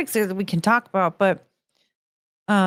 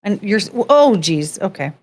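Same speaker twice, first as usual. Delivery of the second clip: usual speaking voice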